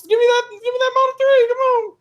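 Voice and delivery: high-pitched, exaggerated begging voice; comic high-voiced demand; mock pleading. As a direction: badger them in a silly high squeal. Playfully demanding the car.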